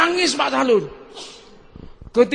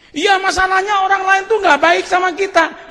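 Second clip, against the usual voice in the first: higher, whiny complaint from a doubter; mock objector; 9 semitones higher, 3 dB louder than usual. Preacher voicing a congregant's complaint, higher and petulant. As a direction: play the grumbling objector.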